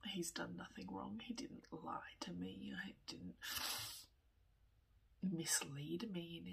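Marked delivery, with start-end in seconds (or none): none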